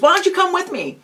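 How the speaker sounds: upbeat demonstrated invite; friendly invitation. Shown as a demonstration. Acted example invitation to a friend.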